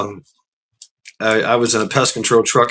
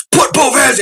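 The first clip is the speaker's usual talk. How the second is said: yelling commands; 12 semitones higher than usual. Retold or imitated. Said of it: "starts yelling fucking commands".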